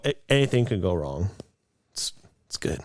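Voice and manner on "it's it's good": breathy whisper, no voice; whispered aside. Real, not acted. Whispering to partner that the fragile Jeep is fine. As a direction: drop to a whisper, almost to herself.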